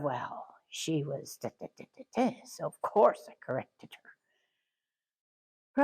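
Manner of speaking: soft, mumbled, self-justifying whisper; muttered excuse. Retold or imitated. Boss excusing herself for scolding a worker, whispered. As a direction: grumble the excuse under your breath. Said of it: "instead of".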